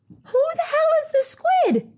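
very high, held-up squeal of disbelief; high exasperated squeal. Retold or imitated. Retelling her outrage at the bathroom hog, squealed in exasperation. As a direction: squeal the whole question way up high. Exasperated retold outburst about squid.

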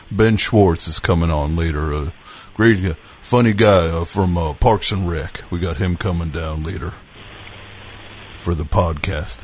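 put-on host the show like a folksy country boy; Southern country podcast host; down-home country host. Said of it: "down-home country guy to be in the mix".

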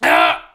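short, loud rough scream; mimed rush scream. Retold or imitated. Boy feeling the drip, sudden loud scream. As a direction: burst out with a raw yell.

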